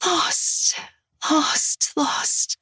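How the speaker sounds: whispered, trailing off to nothing; lost and fading. Acted. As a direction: let each word die away softly. Fading repetition in darkness.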